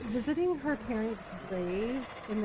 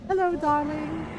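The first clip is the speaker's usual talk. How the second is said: higher, louder mock Astarion greeting; imitating Astarion; 6 semitones higher, 6 dB louder than usual. Astarion greeting her as she wakes, imitated. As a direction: lean into his flirty hello. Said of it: "it's just the way he was like".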